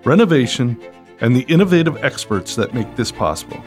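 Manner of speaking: upbeat announcer read over music; bright, punchy show-opening voice; podcast host intro. Acted.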